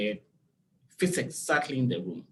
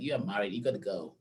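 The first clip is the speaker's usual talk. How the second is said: lower, softer firm father voice; stern dad; 7 semitones lower, 5 dB quieter, faster than usual. Retold or imitated. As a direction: lay down the law like a dad, low. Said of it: "my dad was like".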